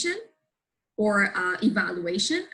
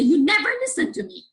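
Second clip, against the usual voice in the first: sharp, high, fast accusation; wife snapping at her husband on his phone; 11 semitones higher, 6 dB louder, faster than usual. Shown as a demonstration. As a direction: snap it out in frustration. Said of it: "just snap and say".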